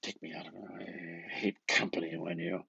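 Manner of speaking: ordinary delivery, nothing marked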